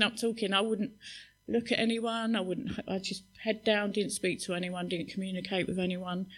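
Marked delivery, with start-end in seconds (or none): none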